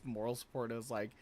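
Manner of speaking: ordinary talk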